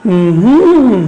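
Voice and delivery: closed-mouth hum that rises and falls; hummed stress pattern. Shown as a demonstration. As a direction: hum of a word's rhythm, swelling on the middle beat. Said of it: "আমার সাথে করতে পারো এভাবে?".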